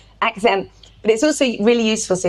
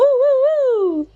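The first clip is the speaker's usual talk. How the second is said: high falsetto woo; light high woo; head voice. Shown as a demonstration. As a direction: float it up in head voice. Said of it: "that's head voice".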